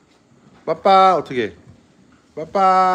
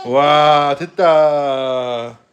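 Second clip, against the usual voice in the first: louder, drawn-out praise; delighted cheer; 8 dB louder, much slower than usual. Real, not acted. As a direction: cheer the little one on. Toddler succeeds at climbing.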